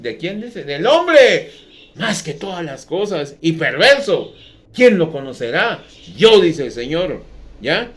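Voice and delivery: loud, fervent pulpit delivery; fiery sermon voice; preaching at full force. Acted. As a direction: thunder it out to the congregation.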